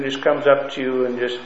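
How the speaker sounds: ordinary delivery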